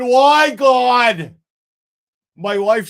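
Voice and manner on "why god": high, loud hammed-up cry to heaven; mock wail. Mocking the ex-cop wailing to God. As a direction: wail it like a ham actor.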